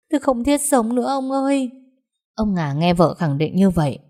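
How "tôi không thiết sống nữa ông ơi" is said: wailing lament. Acted. Wife lamenting to her husband, wailing high. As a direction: cry out to heaven in despair. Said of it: "mếu máo khóc to".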